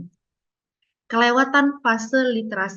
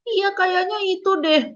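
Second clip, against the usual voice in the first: put-on child finally agreeing; 10 semitones higher, slower than usual. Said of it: "Sampai nanti dia akan bilang".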